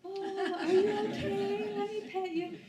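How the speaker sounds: high, coddling, slow sweetness; mock caring voice. People he imagines coddling him, in a high cooing voice. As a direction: pretend to fuss over a baby. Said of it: "they weren't like".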